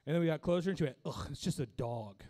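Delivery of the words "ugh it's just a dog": low, let-down mock disgust; deflated, unimpressed put-on grumble; imitating disappointed wife. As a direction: drop from delight to dismissal. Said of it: "Why you gotta fucking say it like that".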